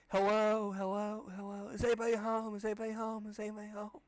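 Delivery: high echoing voice fading out. Pretend. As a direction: let it die away down a hall. Voice echoes back.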